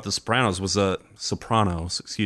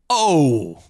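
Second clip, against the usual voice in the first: mock gasp; 5 semitones higher, 5 dB louder than usual. Mock reaction after joke.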